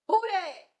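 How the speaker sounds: high, loud startled squeal; scared cry. Retold or imitated. Boy spots a body behind a tree, loud scared squeal. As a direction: yelp it out, spooked in the dark woods. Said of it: "he's like".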